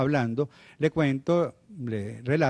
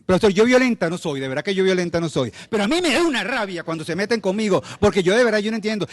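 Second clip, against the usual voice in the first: raised, heated protest, higher; defensive teacher; 6 semitones higher, 4 dB louder than usual. Retold or imitated. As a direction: insist hotly you are not violent. Docente defensiva imitada. (profesora imitada, alterada y a la defensiva)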